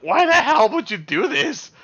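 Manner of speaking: high, loud mock outrage; hurled disbelief at a friend; outraged.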